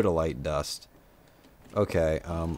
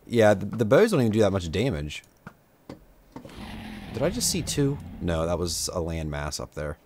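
ordinary delivery

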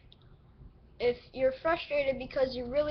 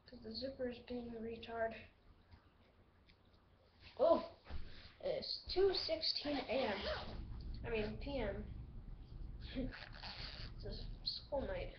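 muttering to himself; 12 dB quieter than usual. Real, not acted. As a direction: mumble it under your breath. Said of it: "pretty much just talking to myself".

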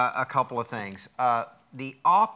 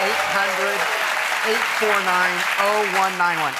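loud, higher TV host announcement; raised announcer voice; 6 semitones higher, 6 dB louder than usual. Real, not acted. Host giving viewers the number, loud announcer call. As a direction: project the phone number over the crowd.

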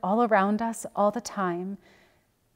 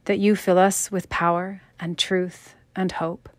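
earnest spoken prayer, slightly raised voice; 4 dB louder than usual. Acted. Pastor leading the congregation in closing prayer, earnest and steady. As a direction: pray it steadily over the congregation.

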